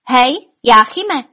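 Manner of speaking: loud, drawn-out call. A narrator's reading. Dwarf to dragon inside the cave, calling out. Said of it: "zavolal trpaslík".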